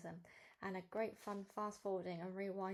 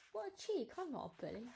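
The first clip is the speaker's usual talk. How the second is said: high-pitched indignant outburst; 6 semitones higher than usual. Acted. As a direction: protest the accusation, pitch leaping up at first. Narrator indignant at being called bully.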